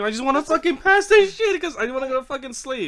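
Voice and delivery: high, loud, whining rant; frustrated outburst. Retold or imitated. Tired player answering why he is so mad, frustrated outburst. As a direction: blurt it out at the end of your patience. Retold outburst about finishing game.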